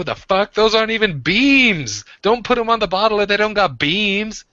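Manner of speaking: high, loud indignant rant; mock outrage. Real, not acted.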